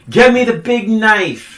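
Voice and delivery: high, loud mock demand; hammed-up, high-pitched film quote; dramatic movie outburst. Acting out film's dramatic line.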